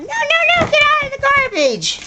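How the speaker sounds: very high, squeaky no-no; high scolding pet voice. Real, not acted. Owner telling Missy out of the garbage, high squeaky scold. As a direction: scold the dog way up high, not harsh.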